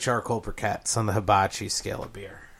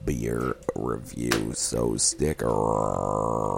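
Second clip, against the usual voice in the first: much lower, slow announcer voice; deep announcer voice; 12 semitones lower, much slower than usual. Pretend. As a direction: drop low and slow like a trailer voice. Mock announcer drop.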